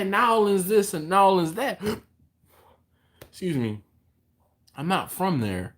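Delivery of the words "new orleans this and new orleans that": "New Orleans" squashed to "N'awlins", pitched up; imitated N'awlins slang. Imagined local chatting about home, "New Orleans" said as "N'awlins". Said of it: "someone is like".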